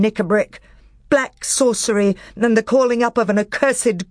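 a king's outraged thundering voice. Acted. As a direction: denounce them like thunder. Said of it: "rose the voice of King Caspian like thunder".

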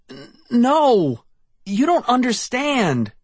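high, stammering panic. A narrator's reading. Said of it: "I stammered".

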